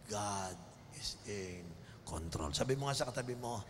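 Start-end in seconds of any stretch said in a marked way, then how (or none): 0.1-2.5 low, very slow declaration; preacher stating faith, slowly; slow solemn declaration. Acted. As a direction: lay each word down slowly.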